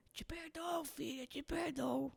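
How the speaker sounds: imitating a frail father, very soft and slow; weak faint voice. Sick father forgiving his daughter from a hospital bed, faint and weak. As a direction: barely voice it, like a sick old man. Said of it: "bem baixinho, assim, que ele tava com a vozinha fraca".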